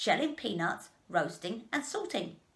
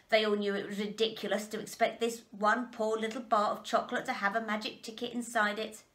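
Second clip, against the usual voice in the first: usual speaking voice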